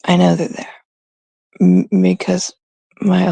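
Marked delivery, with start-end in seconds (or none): none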